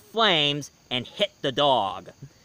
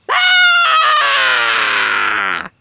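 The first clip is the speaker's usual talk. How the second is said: loud sudden shout; loud ghost-head scream; jump-scare scream; 2 semitones lower, 13 dB louder than usual. Acted. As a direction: startle the listeners. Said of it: "He did this".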